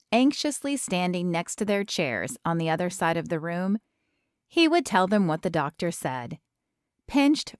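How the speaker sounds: ordinary delivery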